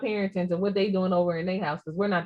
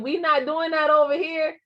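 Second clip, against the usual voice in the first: higher-pitched, playful no-nonsense warning; mock stern threat; 7 semitones higher than usual. Mother warning her child off backtalk, mock stern. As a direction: lay down the law, half in jest.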